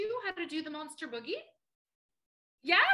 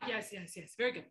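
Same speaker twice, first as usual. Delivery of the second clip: ordinary talk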